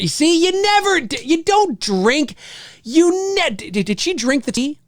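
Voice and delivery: high-pitched, stammering disbelief; high, incredulous outburst; exasperated exclaiming. Real, not acted. Exclaiming at film character's mistake.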